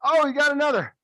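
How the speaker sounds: excited cheer. Real, not acted. Cheering a catch during drill.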